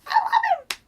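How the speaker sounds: gasp, then very high, loud squeal; gasping fan shriek. Retold or imitated. Fan retelling seeing Luke on the island, squealing. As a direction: gasp and scream his name with love. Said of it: "I was like".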